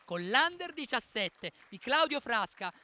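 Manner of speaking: high, loud rising champion call; triumphant proclamation. Real, not acted. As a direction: swell up proudly on the title. Proclama i campioni d'Italia. (proclamazione trionfale, voce alta e squillante)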